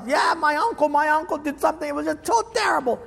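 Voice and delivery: high, hurried pretend whining; mock grievance. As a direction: whine about it in a put-on high voice. Voices another grievance for money.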